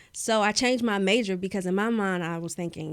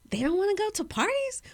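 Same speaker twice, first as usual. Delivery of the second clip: disbelief; 13 semitones higher than usual. Retold or imitated. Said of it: "I would always wonder to myself".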